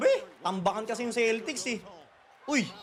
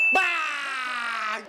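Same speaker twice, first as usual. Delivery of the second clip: long drawn-out "Bang!"; sigaw sa tira. Real, not acted. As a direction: call the made shot with a stretched-out bang. Celebrating a made basket. (pahabang sigaw ng pagdiriwang)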